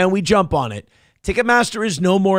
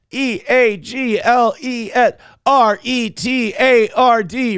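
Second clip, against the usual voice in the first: slow, letter-by-letter spelling; mock spelling bee, slowly; spelled out letter by letter; 5 semitones higher, slower than usual. Shown as a demonstration.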